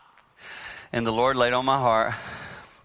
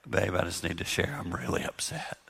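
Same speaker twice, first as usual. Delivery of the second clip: much softer, near-whispered confession; really soft; 5 semitones lower, 7 dB quieter than usual. Shown as a demonstration. Husband telling his wife he is upset, very softly. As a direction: say the upset gently, barely voiced. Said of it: "Like, say it really soft".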